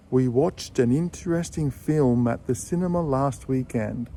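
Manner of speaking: "watched an interesting" joined smoothly; careful linked demo; linked model sentence. Shown as a demonstration. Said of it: "words that finish with a consonant sound".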